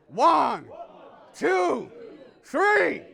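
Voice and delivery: rising shouted countdown; loud count. Acted.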